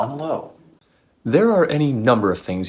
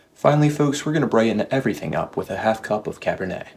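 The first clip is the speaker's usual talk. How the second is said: ordinary delivery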